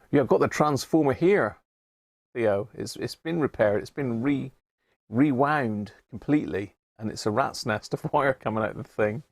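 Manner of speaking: ordinary talk, nothing marked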